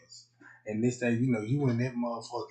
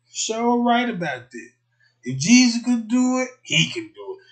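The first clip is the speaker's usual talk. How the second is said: high, loud gushing praise; swooning convert; 10 semitones higher, 10 dB louder than usual. Retold or imitated. Girlfriend gushing loudly over the pastor's sermon. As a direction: gush like she's converted. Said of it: "you like".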